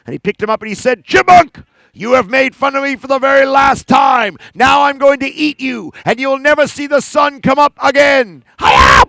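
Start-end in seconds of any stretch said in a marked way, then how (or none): 1.0-8.4 loud, angry bear threat; angry bear grabbing chipmunk; threatening bear. Acted. 8.6-9.0 sharp loud karate cry; loud karate kiai; karate yell. Acted. Karate shout.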